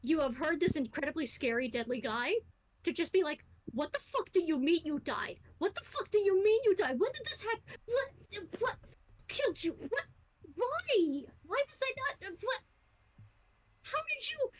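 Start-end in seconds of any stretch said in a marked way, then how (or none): 3.7-14.5 worried angry outburst. Acted. Scary samurai frantically demands how his lord died. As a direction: panic boiling into fury. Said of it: "worried and angry and upset".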